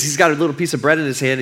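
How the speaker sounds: usual speaking voice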